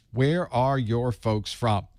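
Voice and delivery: slow, drawn-out friendly question; slow Southern ask. Shown as a demonstration. Host asking chat viewers where their family is from, slow and friendly. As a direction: stretch it out like a Southern neighbor. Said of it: "that's how we work here in the South".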